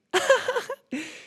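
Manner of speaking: high squealing laugh. Real, not acted. Loud laugh.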